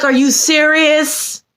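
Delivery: high, slow, incredulous outrage; pissed off. Retold or imitated. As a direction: draw it out in appalled disbelief. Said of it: "I was pissed".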